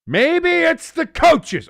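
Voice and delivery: high, loud, slow promo yell; wrestling promo. Acted. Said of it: "I'm doing a promo".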